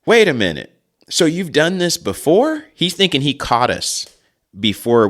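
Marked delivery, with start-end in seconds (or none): 0.1-2.6 suspicious dad. Retold or imitated. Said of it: "he goes".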